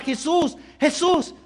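high crying out to Jesus; calling out. Acted. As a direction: call out to him across the crowd. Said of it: "empieza a gritar".

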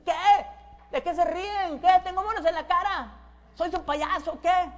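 high, indignant demands; acted touchy outburst; defensive challenge. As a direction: confront them as if they are mocking you. Acted paranoid man confronting laughers.

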